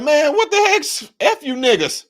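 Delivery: lampoon the mayor's brazen dismissal; mock mayor. Imitating mayor, hammed up.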